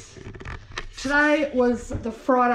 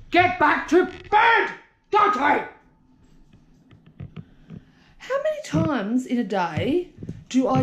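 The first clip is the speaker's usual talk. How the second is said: yelling at dog; 4 semitones higher than usual. Real, not acted. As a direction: bark the order across the house.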